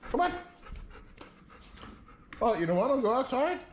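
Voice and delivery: very high calling the dog out; high dog-talk call. Real, not acted. Calling Buster to come outside, very high coaxing call.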